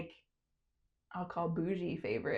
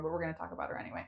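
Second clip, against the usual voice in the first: quick whispered aside, voice dropped low; playful whisper about the producer; 3 semitones lower, 7 dB quieter, much faster than usual. Real, not acted. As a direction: lean in and whisper it fast.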